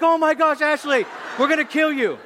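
high, loud crowd outrage; mock outraged shouting. Everybody turns on the girlfriend, outraged shouting. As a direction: imitate scandalized teens yelling.

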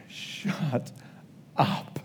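breathless awed whisper; stunned whisper. Retold or imitated. Receiving a gift from the Spirit, awed whisper. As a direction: hush it out in disbelief. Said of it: "you are gonna be like".

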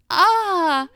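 high, loud retold "ah"; girl's startled cry. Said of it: "And then she goes".

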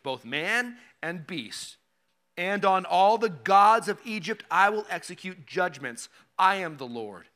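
solemn scripture read. Acted. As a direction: read it out like the voice of the Lord. Reading scripture aloud in sermon.

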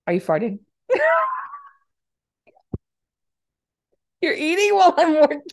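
high, loud, breaking into laughter; real giggling fit, squeaking up high; can barely get the words out for laughing; laughing through words. Real, not acted. Cracking up at cohost.

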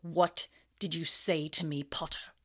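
low, quiet menace; cold dangerous teacher; dangerous Snape. Acted.

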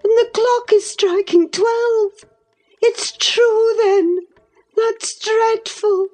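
put-on high, appalled young woman; horrified. A narrator's reading. Catherine hears midnight strike and is horrified. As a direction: dread rising in every word.

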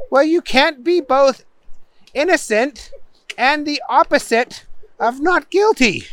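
high-pitched, spaced-out explanation; judge explaining. A narrator's reading. Judge explaining to muddled Booger, high and deliberate.

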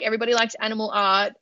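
ordinary talk, nothing marked

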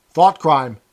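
loud outburst on the edge of blubbering; understated blubber, said loudly; almost blubbering. A narrator's reading. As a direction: burst it out, close to tears. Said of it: "almost blubbering".

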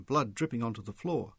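ordinary delivery, nothing marked

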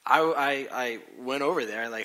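usual speaking voice

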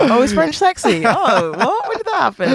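high-pitched giggly feigned innocence; playfully astonished, voice climbing high; mock surprised. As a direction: act clueless, laughing through the questions. Joins the mock-clueless act.

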